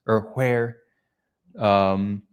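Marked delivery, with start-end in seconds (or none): 0.3-0.8 "where" said as "hwere", with H before W; H pronounced. Shown as a demonstration. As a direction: sound the H at the start of "where". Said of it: "they pronounce the H in".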